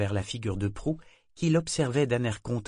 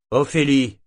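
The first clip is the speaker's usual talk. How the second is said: sharp warning call, louder and higher; menacing; 5 semitones higher, 10 dB louder than usual. A narrator's reading. Captain warning the figurehead, loud and menacing. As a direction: bark her name as a warning. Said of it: "d'un ton menaçant".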